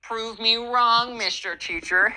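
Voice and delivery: lightly mocking know-it-all; smug challenge. Gen Z kid challenging the teacher, smug mock taunt. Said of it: "He's like".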